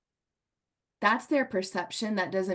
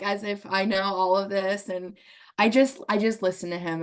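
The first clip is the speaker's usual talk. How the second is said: ordinary talk, nothing marked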